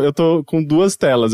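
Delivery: ordinary talk, nothing marked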